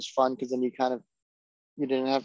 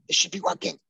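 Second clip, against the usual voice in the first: mimicking himself losing patience, higher pitched; short-circuiting exasperation; 5 semitones higher, faster than usual. Imitating himself when tech goes wrong, exasperated. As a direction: raise the pitch in mock tech frustration. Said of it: "I don't know why I short circuit".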